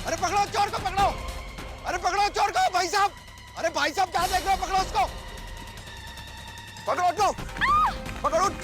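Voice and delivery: insistent alarm calls; urgent calling out. Acted. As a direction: shout to bystanders to grab the thief. Calling bystanders to catch thief.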